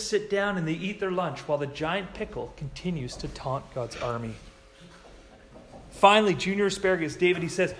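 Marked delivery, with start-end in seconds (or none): none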